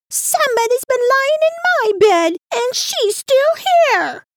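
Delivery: extremely high, piercing little bear squeal; sharp, shrill little bear. A narrator's reading. Little bear finding Goldilocks in his bed, sharp and shrill. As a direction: shriek it shrilly, drawing out the ending. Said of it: "so sharp and shrill".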